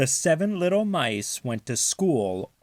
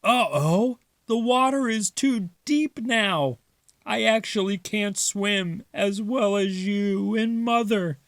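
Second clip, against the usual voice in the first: Father higher, a little embarrassed; sheepish Father; 9 semitones higher than usual. Acted. Father admitting to the kids he can't swim, sheepish.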